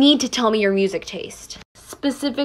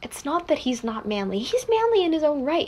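ordinary delivery

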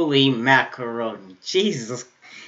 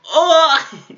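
high loud sudden cry; surprised yelp; 13 semitones higher, 7 dB louder than usual. Real, not acted. Startled yelp at the anime scene. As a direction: jolt up in surprise.

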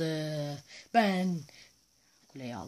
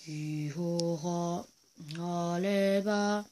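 slow, drawn-out nonsense chant; silly chant; much slower than usual. Pretend. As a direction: gloat in gibberish. Nonsense cheer. (anlamsız uzatılmış tezahürat)

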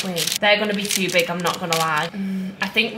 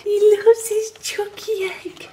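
high, slow, part-whispered cooing; silly baby talk; 10 semitones higher, slower than usual. Pretend. Partner teasing him about his egg, high drawn-out baby talk. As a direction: draw it out in a squeaky mock coo.

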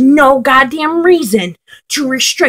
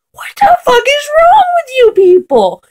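much higher, strained raging cry; furious outburst; 8 semitones higher than usual. Real, not acted. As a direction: let the outrage crack out at YouTube. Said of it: "I have not been this angry".

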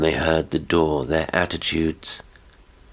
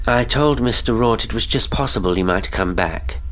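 higher-pitched, steady woman's voice; unwavering voice; 6 semitones higher than usual. A narrator's reading. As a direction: state it without a quiver. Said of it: "in a voice that never quivered".

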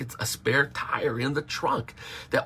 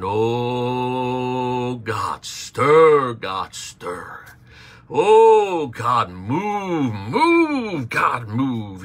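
overdone fervent pleading, mocking; mock prayer-meeting wail; 6 dB louder, much slower than usual. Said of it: "you might hear somebody, they're saying".

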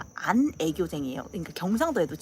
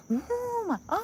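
high, wowed mock gasp; big reaction; 13 semitones higher, much slower than usual. Imitating Japanese women reacting generously, wowed. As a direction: coo in exaggerated amazement, pitched high. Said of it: "반응도 잘해주고 약간 이런 느낌".

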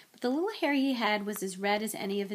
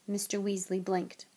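usual speaking voice

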